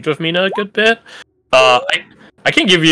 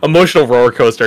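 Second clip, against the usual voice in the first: ordinary talk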